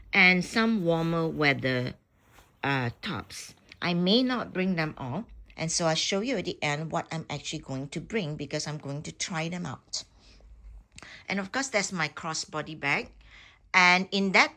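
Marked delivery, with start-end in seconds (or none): none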